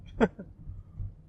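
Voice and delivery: breathy laugh. Real, not acted. Amused by the confused lady.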